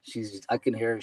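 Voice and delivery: ordinary talk, nothing marked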